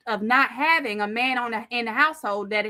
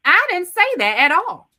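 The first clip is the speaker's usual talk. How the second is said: higher, louder insistence; raised, exasperated voice; indignant denial; 6 semitones higher, 5 dB louder than usual. Real, not acted. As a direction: deny it forcefully. Heated argument, repeating denial.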